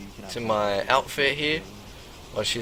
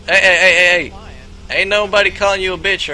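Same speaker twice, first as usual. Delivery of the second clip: loud, higher hey hey to cool him down; calming shout; 4 semitones higher, 6 dB louder than usual. Retold or imitated. Pimp loudly calming the angry stranger. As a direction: shout him down before it escalates. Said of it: "I'm like".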